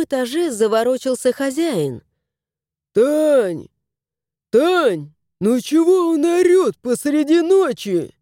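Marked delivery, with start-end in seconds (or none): 2.9-8.1 irritated woken husband. A narrator's reading.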